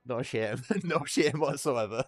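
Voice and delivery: giggling through the words; laughing. Real, not acted. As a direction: laugh while saying it. Laughing at his purchase.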